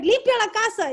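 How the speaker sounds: lightly voiced nagging mother; imitating mom. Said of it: "She would be like".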